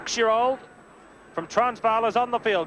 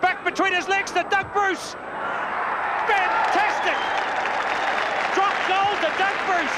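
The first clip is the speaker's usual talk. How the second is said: much higher, thrilled commentary; excited call; 6 semitones higher than usual. Real, not acted. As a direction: lift on the pass and explode on the drop goal. Commentator calls a drop goal.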